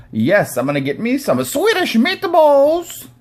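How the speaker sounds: high, loud cartoon Swedish accent; mock Swedish accent. Host showing what he never does, absurd Swedish accent. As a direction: climb high and drag it out in fake Swedish. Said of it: "I'm Swedish".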